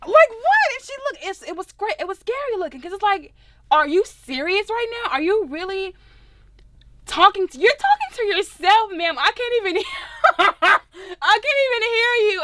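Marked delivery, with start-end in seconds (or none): 0.1-0.7 much higher, louder: what?; baffled, can't believe she's doing this; stunned disbelief. Retold or imitated. To the girl mouthing through her car window, stunned disbelief. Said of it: "I'm like". 3.7-12.4 higher and louder, exasperated disbelief; incredulous retort. Retold or imitated. Mocking the girl yelling alone in her car, incredulous.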